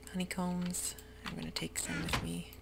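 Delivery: usual speaking voice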